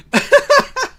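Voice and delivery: loud, high laugh burst; real burst of laughter. Real, not acted. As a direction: crack up after the punchline. Laughs after kill you line.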